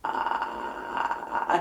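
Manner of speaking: very low creaky uh; vocal fry. Shown as a demonstration. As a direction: creak it out, lowest you can. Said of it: "just repeat after me".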